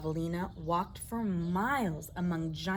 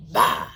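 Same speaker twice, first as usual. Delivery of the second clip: scornful bah; 12 dB louder than usual. Acted. Coyote scoffs.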